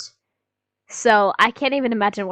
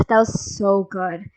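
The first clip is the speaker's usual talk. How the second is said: ordinary talk, nothing marked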